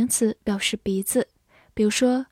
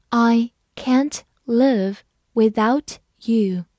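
slow, each word on its own; slow reading; slower than usual. Shown as a demonstration. Teacher asking learners to follow along, slowly word by word. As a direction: lead a read-along, pausing between words. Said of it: "好，跟着我慢慢来读".

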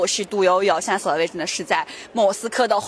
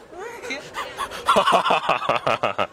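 long laugh; 5 semitones lower than usual. Shown as a demonstration. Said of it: "Long laugh".

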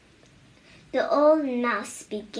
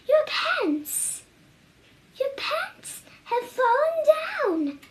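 much higher shrill-voiced ladies in shock; shocked ladies screaming. A narrator's reading. Ladies passing by scream at the old mouse's fallen pants. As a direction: squeal it like startled ladies. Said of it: "but the ladies screamed".

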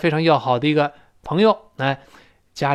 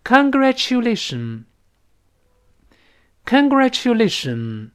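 very slow, high "congratulation"; slow teacher's demonstration, lifted pitch; read-along model; 7 semitones higher, much slower than usual. Shown as a demonstration. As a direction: stretch "congratulation" out for repeating. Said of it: "再读一遍".

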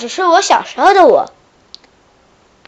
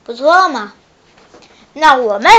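ordinary delivery

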